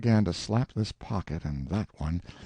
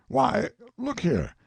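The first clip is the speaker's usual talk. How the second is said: excited; 14 semitones higher, slower than usual. Acted. Colonel excitedly expounding.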